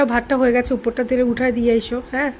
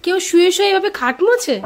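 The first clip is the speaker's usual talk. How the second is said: amazed exclamation; 6 semitones higher, 4 dB louder than usual. Real, not acted. Wife astonished at husband's cleaning.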